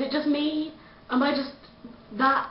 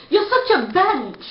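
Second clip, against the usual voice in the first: mock angry shout; 7 semitones higher, 5 dB louder, slower than usual. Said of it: "all you can come out with is".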